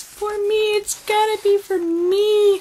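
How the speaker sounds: high-pitched mock squeal of longing; acting out giddy hope in a high voice; retold excited wishing. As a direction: imitate your own excited squeal, very high. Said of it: "I was just like".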